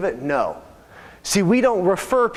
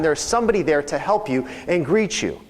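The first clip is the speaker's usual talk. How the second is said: usual speaking voice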